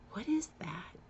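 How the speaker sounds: hushed, breathless whisper; whispered question. Retold or imitated. Staring at the ultrasound, whispering to the nurse in awe. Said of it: "And I'm like".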